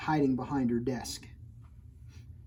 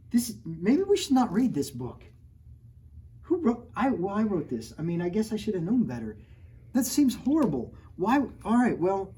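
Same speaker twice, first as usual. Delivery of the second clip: much higher, louder, scandalized; mock horror; 7 semitones higher, 4 dB louder than usual. Real, not acted. Author pretending to be appalled by her own book. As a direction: ham up the shock at the story.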